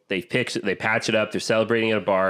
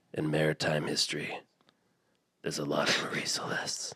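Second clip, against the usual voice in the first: low whispered portent; grave whisper; 6 semitones lower, 9 dB quieter than usual. Retold or imitated. Captain warns his crew, grave low whisper. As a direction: hush it like a dark secret. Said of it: "he's very serious".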